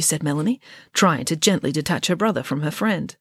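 ordinary delivery, nothing marked

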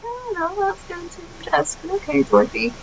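mock alarm. Podcaster reacting to Dorothy eating limestone, high mock worry. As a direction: fret like a fussing onlooker.

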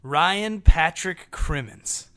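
very slow, careful name; slow deliberate. Shown as a demonstration. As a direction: stretch each name out. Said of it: "say their names correctly".

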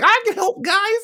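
very high, hammed-up eagerness; mock eager helper. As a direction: imitate a hapless tagalong piping up. [name] acted as overeager helper.

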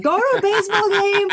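high, excited squeal of a quote; super excited. Retold or imitated. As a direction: gush it like a thrilled fan. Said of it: "Super excited".

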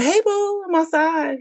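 very high, sugary bait voice; mock-innocent girlfriend; fake sweet. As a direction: sing it sweetly to trap him. Fake-sweet call from the car.

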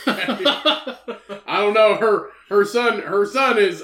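can barely get it out for laughing. Real, not acted. Cracking up about the Queen's old son, laughing while talking.

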